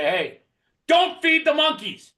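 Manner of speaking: high, loud, slow warning shout with a laugh; laughing, loud and stretched-out warning. Shown as a demonstration. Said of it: "raise their voice a little bit and say".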